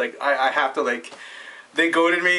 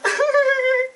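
very high, loud mimicked cackle; imitated little laugh; 5 dB louder than usual. Sticks laughing in the background, imitated high squealing laugh. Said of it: "like, in his little laugh and stuff".

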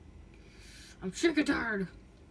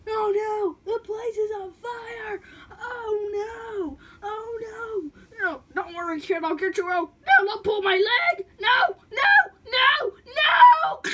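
panicked crying; 10 semitones higher, 10 dB louder, slower than usual. Acted. House fire panic.

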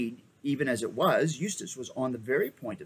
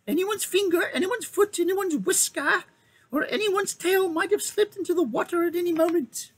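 much higher small mouse voice, eager and courtly; a brave little mouse offering his service; gallant mouse; 4 dB louder than usual. Acted. The mouse knight offers to dive for the king, high and eager.

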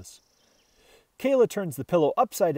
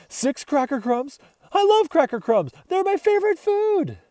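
rising higher, louder gushing; excited dog; 11 semitones higher, 5 dB louder than usual. Acted. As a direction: build to a greedy happy squeal. Dog raving about crumbs.

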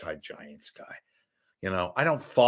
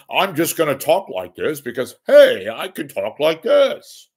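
artificial voice; 5 semitones higher, 8 dB louder than usual. Retold or imitated. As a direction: mimic a phony broadcaster showing off. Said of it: "who talks artificially".

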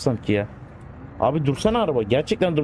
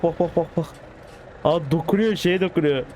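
ordinary talk, nothing marked

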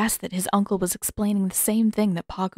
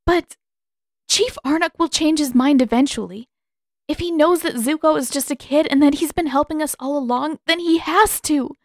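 urgent pleading; 7 semitones higher, 4 dB louder than usual. Acted. Katara pleads for Zuko's safety.

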